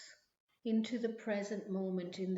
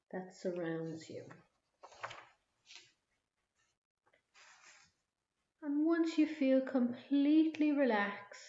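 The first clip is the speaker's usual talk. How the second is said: guided meditation. Acted. Leads a relaxation visualization.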